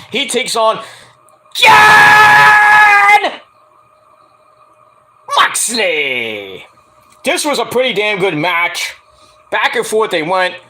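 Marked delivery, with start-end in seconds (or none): 1.5-6.6 huge drawn-out shout, high then sliding down; ring announcer call. Retold or imitated. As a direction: bellow the name like a ring announcer. Imitates ring announcer's name call.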